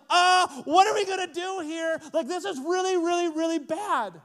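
high, loud panicky voice; panicked disciples. Retold or imitated. Disciples panicking in the sinking boat, panicky.

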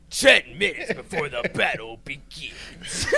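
slow, breathy, laughing announcer whisper; Halo announcer. Pretend. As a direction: ham up a game announcer, slow, breaking into laughs. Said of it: "the guy that does, like, the Halo announcements".

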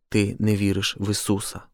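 usual speaking voice

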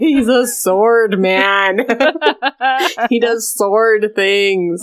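slow, drawn-out words broken by laughter; giggly delight. Real, not acted. Podcasters gush over the sword-wielding hero, giggly delight. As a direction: savour each word, then crack up.

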